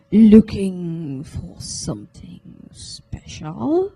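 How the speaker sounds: slow, raspy creepy shopkeeper; raspy slow old man. Acted. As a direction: draw it out like grinding cogs. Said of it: "raspy and slow".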